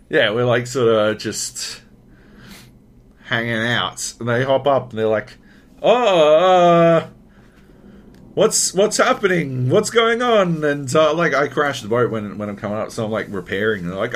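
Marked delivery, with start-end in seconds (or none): 5.8-10.6 high, loud mock broken-English confusion; raised voice in halting foreign English. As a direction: imitate a puzzled broken-English speaker. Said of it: "it's super broken English".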